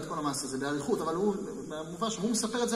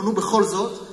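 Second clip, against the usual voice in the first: pushy coaxing; 3 semitones higher, 11 dB louder than usual. Acted. Rabbi pressing the boy to answer.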